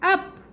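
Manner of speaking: अप said high and short; demo word. Shown as a demonstration. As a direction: model अप briskly. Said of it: "जैसे बट". ("अप" ऊँचा और छोटा)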